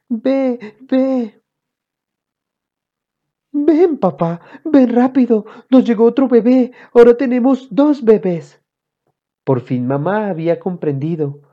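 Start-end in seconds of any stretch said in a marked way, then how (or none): 0.1-1.4 put-on very high baby-talk voice; high mock infant voice; pretend baby babble. A narrator's reading. 3.5-8.6 high, loud excited mom; high-pitched, thrilled shouting; excited calling out. A narrator's reading. Mother excitedly calls father over.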